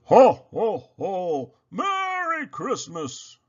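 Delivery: loud, slow booming Santa greeting; strong Santa call. A narrator's reading. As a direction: boom it from behind the door. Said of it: "a strong voice calls out".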